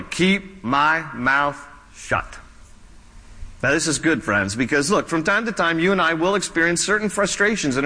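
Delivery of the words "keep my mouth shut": measured, resolute vow with a pause before the final word; deliberate resolve. Acted. Acting out David's firm resolve.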